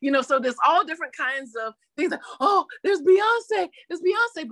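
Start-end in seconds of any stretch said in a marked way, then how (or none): 2.4-4.5 high, gushing fan squeal; starstruck fan excitement. Acted. Fan spotting [name] on a talk show, excitedly starstruck. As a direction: gush it high, stretching the first name.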